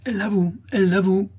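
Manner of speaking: high-pitched, incensed accusation; angry outcry. A narrator's reading. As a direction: cry it out like an outraged crowd. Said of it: "s'écrièrent les laboureurs courroucés". (exclamation courroucée, très aiguë)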